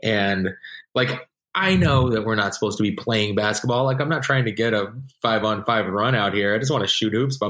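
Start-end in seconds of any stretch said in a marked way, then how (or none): none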